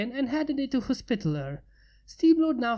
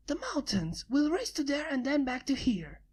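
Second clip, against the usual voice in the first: higher, softer bright challenge; lightly voiced eager pegasus; 5 semitones higher, 4 dB quieter than usual. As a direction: call out the race goal like a tomboy. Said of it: "she said".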